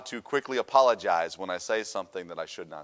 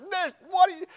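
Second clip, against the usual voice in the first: indignant, much higher and louder protest; offended disbelief; 5 dB louder than usual. Retold or imitated. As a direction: shoot up in outraged disbelief. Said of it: "I was offended".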